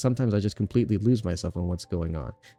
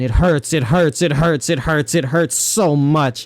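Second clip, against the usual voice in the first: it hurts, louder and higher each time; building anguish; 5 semitones higher, 6 dB louder, slower than usual. Acted. Streamer voicing the cursed girl, mock anguished pleading. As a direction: let the pain climb with every repeat. Girl's lines, building pain.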